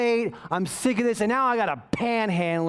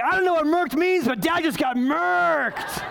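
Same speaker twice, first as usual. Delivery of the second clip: the wife joins in teasing her husband; 8 semitones higher than usual. Retold or imitated. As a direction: play the amused wife. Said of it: "my wife's like".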